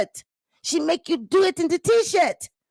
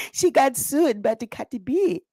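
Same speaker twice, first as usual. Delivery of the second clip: ordinary talk, nothing marked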